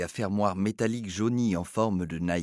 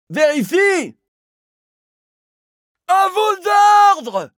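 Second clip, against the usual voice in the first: high, loud, slow bark; barked reply; 13 dB louder, much slower than usual. Acted. Doorman barking his yes-sir. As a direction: Bagvaline snapping back to his boss, barking. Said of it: "aboyait Bagvaline".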